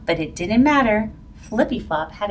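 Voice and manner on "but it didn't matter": ordinary delivery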